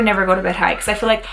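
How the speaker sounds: ordinary talk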